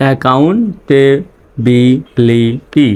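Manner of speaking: very slow "accountability", gaps between syllables; teacher's slow sounding-out. Shown as a demonstration. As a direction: break "accountability" into a-ccoun-ta-bi-li-ty. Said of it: "reading পড়ে আসি".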